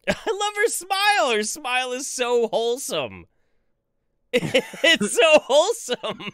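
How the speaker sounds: high, drawn-out words through laughter; real laughing gush. Real, not acted. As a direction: gush slowly, helpless with giggles. Gushing over her smile while laughing.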